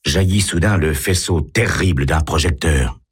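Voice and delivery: usual speaking voice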